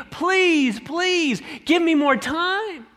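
high, desperate begging, words tumbling at the end; groveling plea. Acted. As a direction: beg for mercy like your life depends on it. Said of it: "He's groveling at the feet of this king".

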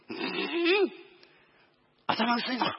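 put-on high slurred drunk salaryman. Drunk passenger mocking the driver's bald head, high slurred voice. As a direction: sneer like a sloppy drunk.

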